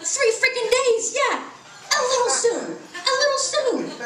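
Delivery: mock-outraged mother's squeal; indignant retort. Mother retorts to son's too soon.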